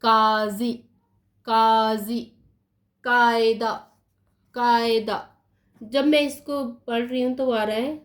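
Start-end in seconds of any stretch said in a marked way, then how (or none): 0.0-2.2 काजी with a throaty uvular q, not plain k; nukta pronunciation. Shown as a demonstration. Teacher reading the Urdu word aloud, throaty काजी. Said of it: "पढ़िए इसको". 3.0-5.2 कायदा with a throaty uvular q; nukta pronunciation. Shown as a demonstration. Teacher reading the Urdu word aloud, throaty कायदा. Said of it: "पढ़िए इसको".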